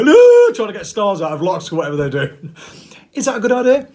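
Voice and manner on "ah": very high, loud hammed-up scream; mock panic scream. Slapping snakes in mock panic, high loud scream. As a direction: shriek while flailing at snakes. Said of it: "slapping snakes around the head and go".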